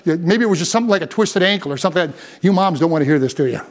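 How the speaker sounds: normal talking voice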